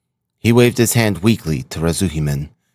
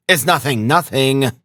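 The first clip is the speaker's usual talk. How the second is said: higher-pitched hurried reassurance; urgent cry; 7 semitones higher than usual. A narrator's reading. Razumihin to the alarmed mother and sister, crying out reassurance. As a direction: cry it out to calm them. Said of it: "he cried to the mother and sister".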